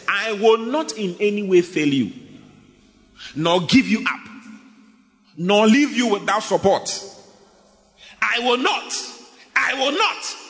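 fervent scripture reading rising to a peak; preaching at full force. Acted. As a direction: preach God's promise, building in pitch. Reads God's promise with rising force.

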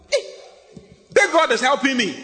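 exultant testimony shout; joyful shout. Pretend. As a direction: shout it out like a rejoicing believer.

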